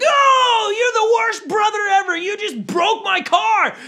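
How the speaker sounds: put-on high, loud, angry child voice; furious kid yell. Boy yelling at his brother over his broken car. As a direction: wail it at the brother who broke it.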